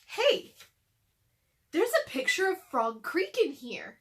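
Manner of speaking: high, loud discovery; sudden surprise at a find; surprised. Acted. Jack finds Frog Creek in the book, surprised.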